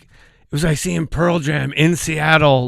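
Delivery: normal talking voice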